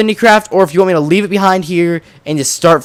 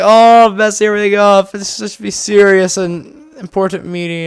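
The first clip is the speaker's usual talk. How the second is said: raised-pitch retold lament; mock distress; 4 semitones higher than usual. Recalling himself messing up the serious meeting, mock distress. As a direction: groan in comic self-reproach. Acting own panic during meeting.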